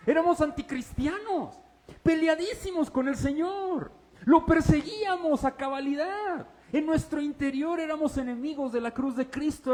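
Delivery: high, insistent preacher's delivery; forceful preaching. Real, not acted. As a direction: drive the charge home to the congregation.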